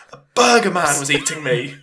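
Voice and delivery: loud hammed-up wail, half laughing; comic panicked confession; mock nightmare distress. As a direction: blurt out the nightmare in mock terror. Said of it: "You're gonna wake up in the middle of the night".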